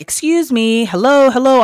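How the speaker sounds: high, loud interjection down the phone; calling out for attention. Retold or imitated. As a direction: call out to someone who is ignoring you. Trying to be heard over phone.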